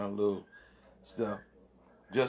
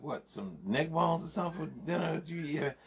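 mock confused woman; 7 semitones higher than usual. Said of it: "He's like".

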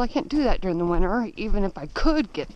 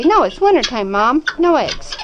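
high loud chicken voice; voicing the chickens; 5 semitones higher, 6 dB louder than usual. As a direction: speak for the hens, high.

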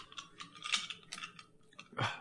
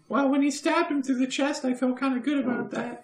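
put-on child in therapy; 13 semitones higher than usual. Traumatized kid answers the mock therapist about the stabbing, high childlike voice. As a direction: put on a small, high voice confiding to a counsellor.

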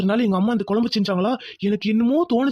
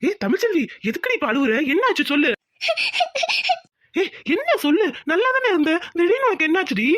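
much higher, urgent questioning; alarmed concern; 9 semitones higher than usual. Acted. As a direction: press a crying wife anxiously, pitch raised. Alarmed husband questions crying wife. (பதறிப்போய் கவலையோடு)

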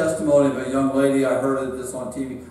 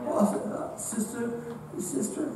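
soft, higher-pitched list of reminders; hushed reproach; 6 semitones higher, 8 dB quieter than usual. Acted. God reminding sinner who told him, hushed reproach. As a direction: go soft and high, adding each name haltingly.

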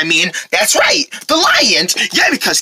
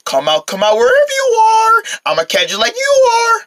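sing-song calling; 11 semitones higher than usual. Acted. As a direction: coax someone out of hiding in a high lilt. Calling out to hidden lions.

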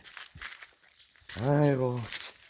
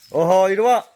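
high, loud warning to the dog; stern raised reprimand; scolding call; 6 semitones higher, 9 dB louder than usual. Real, not acted. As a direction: stop him with a sharp call.

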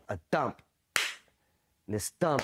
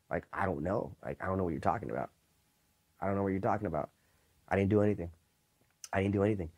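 acted denial; 4 semitones lower, faster than usual. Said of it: "Deny it till you die".

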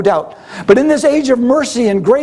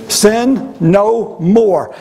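very slow, each word stretched; drawn out; much slower than usual. Acted. Preacher repeating Jesus' command to the congregation, slow and weighty. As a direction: draw out "Sin no more" deliberately.

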